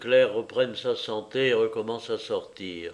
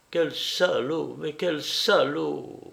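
slow, slightly raised voice, as if in a daze; semi-delirious repetition; 4 semitones higher, slower than usual. A narrator's reading. Claire repeating herself in shock after the tragedy, semi-delirious. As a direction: repeat it slowly, half-delirious. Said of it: "Dans l'automatisme d'un demi-délire".

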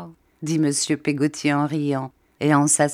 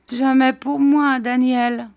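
higher, whining widow voice; high plaintive old woman; 8 semitones higher, 4 dB louder, slower than usual. A narrator's reading. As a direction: moan it like a self-pitying widow. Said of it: "repartit mistress Gummidge".

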